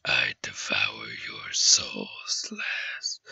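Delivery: very low, slow, whispery menace; dark, drawn-out soul-eater voice; menacing creature voice. Acted. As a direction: growl it softly from the very bottom of your range. Voicing a soul-devouring game character.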